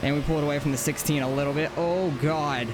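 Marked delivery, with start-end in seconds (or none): none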